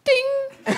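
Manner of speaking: high bright ding for a wink; high pitched ding; wink sound effect. Shown as a demonstration. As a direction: chime out a cartoon wink. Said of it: "The sound of a wink".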